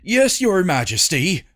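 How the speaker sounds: loud, eager high yell; enthusiastic yell. Acted. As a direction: yell it with zeal. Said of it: "yelled the overly enthusiastic driver".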